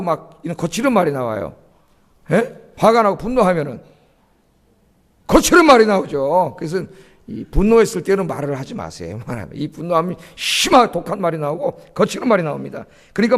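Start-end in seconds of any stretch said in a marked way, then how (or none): none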